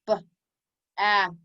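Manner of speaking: "B-a-th" broken up: b, short a, long th; tapped-out sounds. Shown as a demonstration. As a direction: sound out "B-a-th" with clear gaps. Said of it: "Tap it out".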